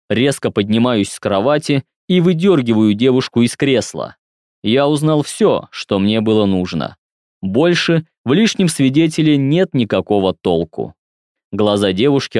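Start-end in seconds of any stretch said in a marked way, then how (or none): none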